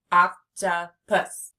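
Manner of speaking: "Octopus" as three loud, spaced beats; teacher counting out "Octopus"; split into syllables. Shown as a demonstration. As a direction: say oc, tuh, pus slowly for "Octopus". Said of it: "Three syllables".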